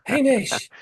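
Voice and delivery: high delighted cry of the name; excited shout. Retold or imitated. Players hailing the star as he is revealed. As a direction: call his name in glee.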